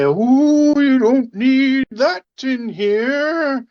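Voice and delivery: high, slow hooting ghost; drawn-out spooky falsetto. Acted. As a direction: wail it like a meddling phantom. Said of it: "the voice say".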